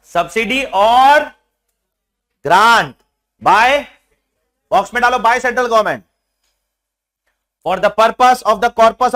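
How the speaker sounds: each word spaced out and pushed; slow loud dictation. Shown as a demonstration. Teacher reads the law aloud to students, slow and loud. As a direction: dictate it so the class can copy.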